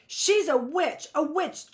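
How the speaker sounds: high, loud scream. A narrator's reading. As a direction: scream the accusation. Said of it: "Judith screamed".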